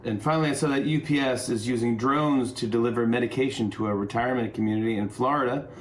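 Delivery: usual speaking voice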